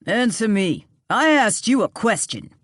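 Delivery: Harvey shouting his question hard at the stranger; angry shout. A narrator's reading. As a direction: bark the demand at the man. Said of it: "Harvey shouted".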